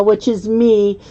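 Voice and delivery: ordinary talk, nothing marked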